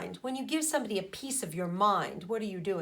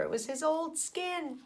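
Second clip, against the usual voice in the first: ordinary delivery, nothing marked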